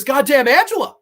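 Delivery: loud, high jolt of annoyance; startled cursing. Acted. Allie startled by Angela popping up, cursing loudly. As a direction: curse as if someone jumped out.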